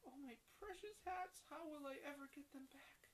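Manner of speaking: high, whispered lament; mock crying. Hat seller crying into his hands, a mock sob. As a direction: wail over the lost hats, half in play. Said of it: "began to cry".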